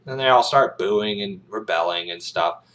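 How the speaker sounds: ordinary delivery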